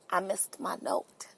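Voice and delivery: breathy hushed confession; hushed whisper. Pretend. Host mourns her missed note in a whisper. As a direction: whisper it like a tragic secret.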